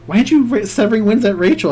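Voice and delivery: high, loud incredulous quote; baffled questioning. Retold or imitated. Opponent looking at his hand asks him why, baffled.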